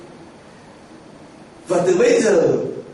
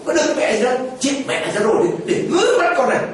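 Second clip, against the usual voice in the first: a sneering wife taunting her sick husband, with laughs; wife scoffing at her suffering husband's prayers, mocking voice; 4 semitones higher, faster than usual. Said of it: "bà đi qua bên".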